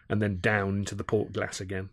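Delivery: plain reading voice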